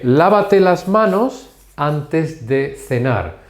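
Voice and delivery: half-speed, carefully separated phrases; slow model sentence. Shown as a demonstration. Teacher gives an example of time and sequence, slowly. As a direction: dictate it slowly to learners.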